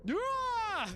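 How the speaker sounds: high, long shocked wail; drawn-out high yelp; startled cry. Real, not acted. Startled by thing in game.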